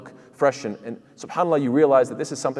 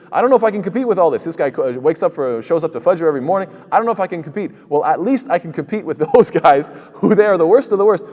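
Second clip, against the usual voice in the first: higher, louder, rushed self-doubt, half-laughing; mock despair; 5 semitones higher, 6 dB louder than usual. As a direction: play the worshipper who feels outmatched. Acting a discouraged believer's thoughts.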